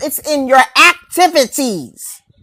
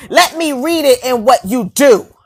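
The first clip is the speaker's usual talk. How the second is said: ordinary delivery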